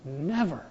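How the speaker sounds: Never said soft and falling; quiet emphasis on Never; stress on never. Shown as a demonstration. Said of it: "Would you circle that word?".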